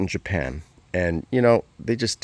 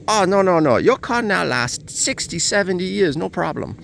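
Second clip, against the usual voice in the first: imitating a Japanese engineer reassuring him, pitched up; Japanese accent; 10 semitones higher than usual. Engineer assures the owner the truck lasts, high mock Japanese accent. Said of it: "Sorry for the accent".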